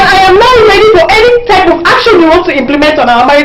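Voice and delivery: loud, fast, high-pitched fury; furious shout. Acted. Wife shouting at husband about their marriage, furious. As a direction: hurl it at him.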